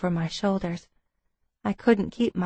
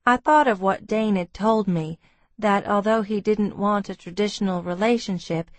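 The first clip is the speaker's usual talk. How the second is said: usual speaking voice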